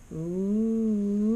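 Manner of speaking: ooh climbing in steps then dropping straight down; walk the voice up the stairs and jump off. Shown as a demonstration. Teacher demonstrates the stairs card with stepped ooh. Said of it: "And listen again".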